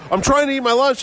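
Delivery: mock a grumpy man defending his sandwich; annoyed artist. Artist eating a tuna sandwich snaps at passerby, high whiny gripe. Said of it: "He goes".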